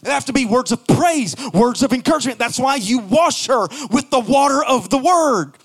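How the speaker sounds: rising, chanted preaching. Acted. Pastor preaching to the congregation, rising chant. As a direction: build the sermon to a sung climax.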